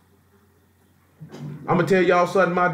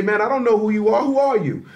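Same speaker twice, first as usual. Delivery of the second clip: higher, lightly voiced doubting gatekeeper; dismissive gatekeeper; 7 semitones higher than usual. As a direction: toss the question off, a touch higher. Voicing the one refusing Esau.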